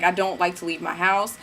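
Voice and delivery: ordinary talk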